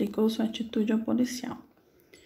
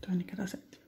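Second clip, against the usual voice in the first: whisper; 9 dB quieter than usual. Real, not acted. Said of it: "correcting own note".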